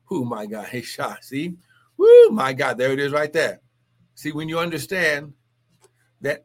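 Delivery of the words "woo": high, loud "woo"; ecstatic whoop. Real, not acted. Preacher moved by God's promises, ecstatic whoop. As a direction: let a sudden praise whoop fly.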